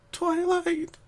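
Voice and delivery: high, tearful crying voice; sniffing through sobs. Acted. Pinky sobbing to Twilight after the nightmare. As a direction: choke her name out through tears. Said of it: "Pinky sniffed through her sobs".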